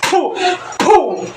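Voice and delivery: a sharp puff of breath blown out; blowing puff. Real, not acted. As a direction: blow a quick burst of air as if casting out a spirit. Healer blowing twice.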